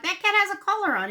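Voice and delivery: quick, high-pitched disbelief at the cat; surprised blurt. Retold or imitated. Telling husband the strange cat wears a collar, surprised and quick. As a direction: blurt it out fast and high, amazed. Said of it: "I'm like".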